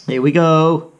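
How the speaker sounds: ordinary delivery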